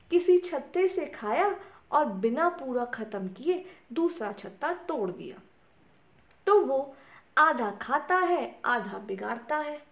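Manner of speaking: ordinary delivery, nothing marked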